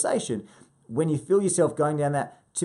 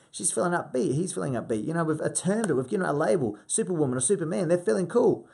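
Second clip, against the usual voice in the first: ordinary talk, nothing marked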